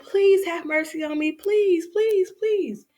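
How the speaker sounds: high, squeaky begging; pleading mouse. Acted. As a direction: beg for your life as a tiny mouse. Mouse begs lion for mercy.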